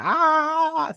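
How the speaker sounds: high, loud drawn-out "ah"; imitated dragon roar. Fan imitates the dragon sound they love, high roar. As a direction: mimic a dragon's cry in one long high note. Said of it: "I love the sound they make".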